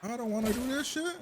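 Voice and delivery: high, loud protest at the screen; outraged yell. Real, not acted. Viewer yelling at the tournament fight, outraged. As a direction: yell at the knight on screen in disbelief.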